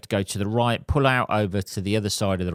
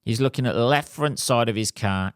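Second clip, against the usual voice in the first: ordinary delivery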